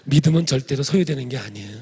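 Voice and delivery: normal talking voice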